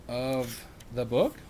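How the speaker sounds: plain reading voice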